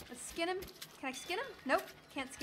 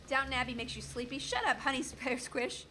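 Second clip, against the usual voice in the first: ordinary talk, nothing marked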